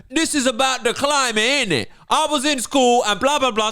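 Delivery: exaggerated London schoolgirl street accent; mock street accent. Video child explaining climate to viewers, mock street accent. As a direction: parody an urban youth accent. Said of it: "Okay, here's her accent".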